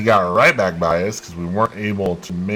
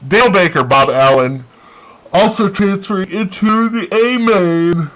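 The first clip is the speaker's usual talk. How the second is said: excited; 10 semitones higher, 6 dB louder than usual. Real, not acted. Reading out results.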